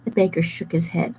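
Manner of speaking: usual speaking voice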